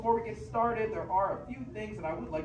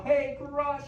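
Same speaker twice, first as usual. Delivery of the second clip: call out; 8 semitones higher, 5 dB louder than usual. Shown as a demonstration. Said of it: "we need to yell".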